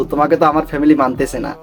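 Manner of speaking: usual speaking voice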